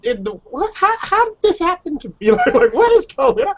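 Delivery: high, squeaky mock astonishment; incredulous high "what?"; baffled disbelief. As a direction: play it as if you can't believe your ears. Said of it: "And I'm like".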